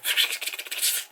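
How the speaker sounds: voiceless sputtering hiss; stuttered "shh-k-k-k" effect; imitated crumbling noise. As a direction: make a crumbling-to-dust sound. Imitates booty crumbling to dust.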